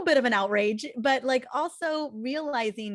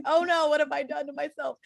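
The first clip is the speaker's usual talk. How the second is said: higher-pitched retold dismay; mock freak-out; 6 semitones higher than usual. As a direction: replay the inner "oh no" with exaggerated alarm. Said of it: "kind of freaking out and thinking".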